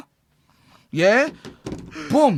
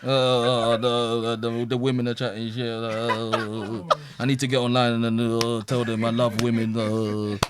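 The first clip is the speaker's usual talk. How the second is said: mock timid man online; 9 semitones lower, slower than usual. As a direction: play a meek man pleading he loves women. Mocking men who go online.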